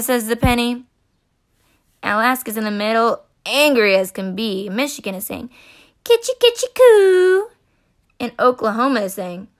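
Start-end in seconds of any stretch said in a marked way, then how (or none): 6.0-7.5 high, loud cooing tickle; tickling baby talk. Acted. Michigan tickling Alaska, high cooing baby talk.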